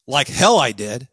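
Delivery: loud, forceful denial; emphatic denial. Shown as a demonstration. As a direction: deny it with real punch. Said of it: "which is the more emphatic denial?".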